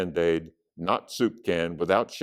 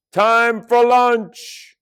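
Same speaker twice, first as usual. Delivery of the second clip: high, loud, drawn-out call; 10 dB louder, much slower than usual. A narrator's reading. Chloe calls the class to lunch, loud and stretched. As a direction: holler it out high and slow. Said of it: "Chloe called".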